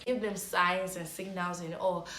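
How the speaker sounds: ordinary talk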